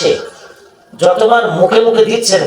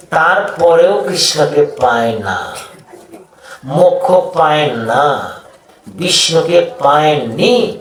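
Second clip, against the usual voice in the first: very slow, stretched phrases; drawn-out emphasis; 2 semitones lower, slower than usual. Real, not acted. Preacher lamenting that even ascetics fail, drawn-out emphasis. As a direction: draw each phrase out slowly, letting it fall. Preacher dwells on failed penance.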